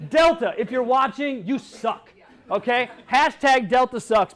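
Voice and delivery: loud, slow, heated jab at Delta; angry call-out. Real, not acted. Speaker scolds Delta over lost bags, loud and slow. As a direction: shout it straight at the airline.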